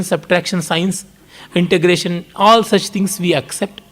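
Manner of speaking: normal talking voice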